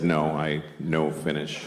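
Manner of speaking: low, slow reply, "Finnish" said like finish; mirroring her English. Retold or imitated. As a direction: echo her broken English back to her, deadpan. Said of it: "And I said".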